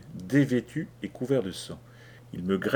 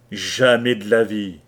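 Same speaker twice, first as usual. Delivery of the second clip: defiant refusal; 8 dB louder than usual. Acted. Kelly refuses Leach loudly.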